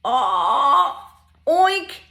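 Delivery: high, loud storybook oink; punchy pig call for kids. Acted. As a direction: oink like a picture-book pig. Voicing the pig.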